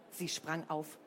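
plain reading voice

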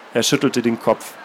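calm, measured reading of a novel; story narration. A narrator's reading. Author reading his novel aloud, steady narration. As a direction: read the passage steadily, letting it speak.

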